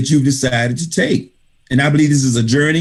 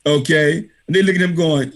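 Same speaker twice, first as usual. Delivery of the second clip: usual speaking voice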